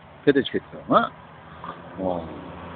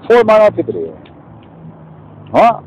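heated rant; 3 dB louder than usual. Real, not acted. Man ranting to his viewers about minorities, loud and heated. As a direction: raise the voice, getting worked up.

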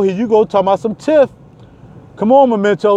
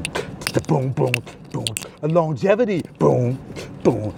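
ordinary talk

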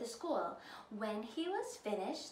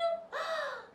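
delighted gasp. A narrator's reading. Ellie sees Godma.

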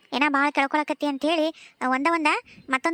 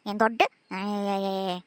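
mocking groan; 11 semitones lower, 5 dB quieter, slower than usual.

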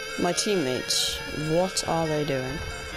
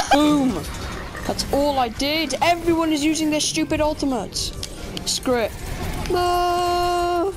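raging gamer; 9 semitones higher than usual. Real, not acted. Raging at enemy ultimates.

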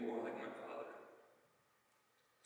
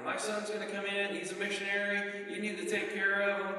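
higher and louder, proud insisting father; insistent dad on the phone; 6 semitones higher, 5 dB louder than usual. Retold or imitated. Father phones dealerships to vouch for his missionary son, insistently raised. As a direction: voice dad pushing the salesman, a touch raised.

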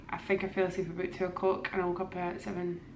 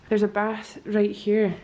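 ordinary talk, nothing marked

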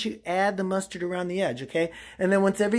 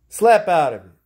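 sudden loud command; 3 semitones lower, 9 dB louder than usual. Acted. Character snapping out of a monologue, sudden and loud. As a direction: bark it out suddenly.

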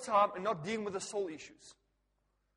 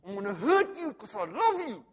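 louder playful menace; mock loving threat; 9 dB louder than usual. Pastor joking to congregation, playful menace. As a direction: threaten out of love, tongue in cheek.

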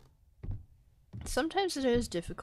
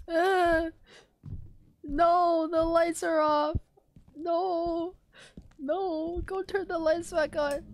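panicked; 6 semitones higher than usual. Real, not acted. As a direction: beg in a panic. Lights off, panicking.

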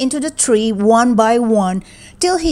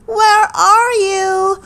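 high, long-stretched call; 9 semitones higher, 3 dB louder, much slower than usual. A narrator's reading. Boy calls to the missing babies, drawn-out searching cry. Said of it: "he called".

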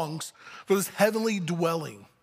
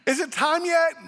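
high, drawn-out pestering question; impatient Paul; 8 semitones higher than usual. Acted. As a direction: ask like a kid who can't wait. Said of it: "Paul groaning to be with Jesus".

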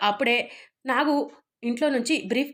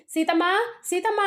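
mock shout for help, pitch climbing higher; calling out loud; 7 semitones higher than usual. Watchman grabbing the intruder, calling for Sita in a high mock shout. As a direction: call her name out twice, rising high. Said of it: "గట్టి గట్టిగా అరుస్తూ".

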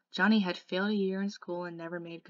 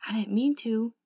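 louder, slightly raised confession; blurted out; 4 semitones higher, 7 dB louder than usual. A narrator's reading. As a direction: let it burst out, mildly distressed. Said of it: "he finally blurted out".

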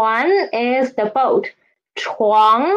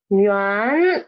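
usual speaking voice

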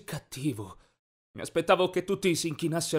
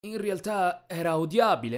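usual speaking voice

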